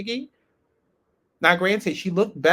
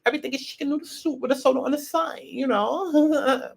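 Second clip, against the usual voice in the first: [name]; 9 semitones higher than usual. Retold or imitated. Introducing a new cast member, high-voiced impression. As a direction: mimic her way of talking, pitched way up. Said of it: "she talks like this".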